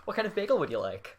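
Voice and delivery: high, bright cashier voice; polite upbeat counter-service question; customer service voice. Shown as a demonstration. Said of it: "Do your customer service voice".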